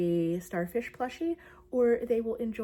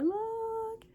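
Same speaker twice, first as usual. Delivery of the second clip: high, drawn-out excited reveal; excited high-pitched show-and-tell; delighted squeal. Real, not acted. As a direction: squeal it high while showing off. Showing off progress excitedly.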